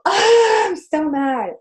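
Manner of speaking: high, loud burst easing off; mock-angry cry, high and drawn out; demonstrated yell. Shown as a demonstration. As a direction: yell it out to move the anger.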